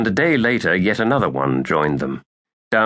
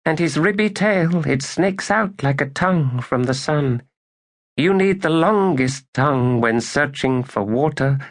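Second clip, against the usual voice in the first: Badal's voice; 8 semitones higher than usual. A narrator's reading. Badal tells of Rut leading the children, in a high dreamy voice. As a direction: speak as the girl Badal, higher and distant. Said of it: "Badal said".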